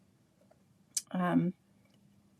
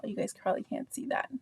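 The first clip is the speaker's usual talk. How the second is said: whispered aside; 8 dB quieter than usual. Real, not acted. Aside to viewers while mixing.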